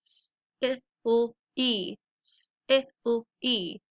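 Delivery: "silly" sound by sound, s, i, l, ee; segmented phonics "silly". Shown as a demonstration. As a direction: sound out "silly" twice with gaps between sounds. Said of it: "So let's practice them".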